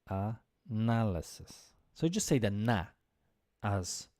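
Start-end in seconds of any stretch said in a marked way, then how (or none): 0.1-1.7 analysis said slowly, na stressed; slow model of analysis, stress on na. Shown as a demonstration. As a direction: stretch out analysis, hitting na. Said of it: "the most important stress".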